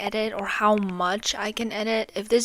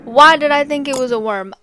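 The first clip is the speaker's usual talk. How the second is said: normal talking voice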